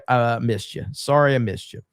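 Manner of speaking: ordinary talk, nothing marked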